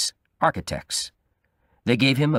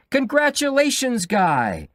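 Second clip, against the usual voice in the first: crowd shouting. A narrator's reading. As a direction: call it out over the wedding crowd. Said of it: "People were all shouting".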